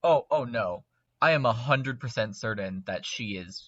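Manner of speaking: ordinary talk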